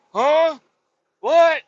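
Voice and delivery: high, loud baffled huh; a lost president who didn't catch it; confused old man. Retold or imitated. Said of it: "One of them's like".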